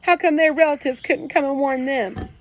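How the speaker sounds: tearful plea. A narrator's reading. Kenny crying about the other kids.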